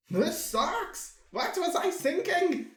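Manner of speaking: high-pitched exasperated outburst; acted frustration. Artist hating his own drawing, high exasperated outburst. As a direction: cry out in self-disgust, pitched high.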